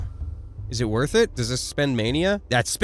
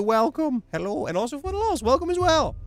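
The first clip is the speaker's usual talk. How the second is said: voiced character; 12 semitones higher than usual. Voicing a game character.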